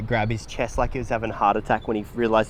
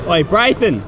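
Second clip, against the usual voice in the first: calling out; 7 semitones higher, 5 dB louder than usual. Real, not acted. Calling out to friend at game.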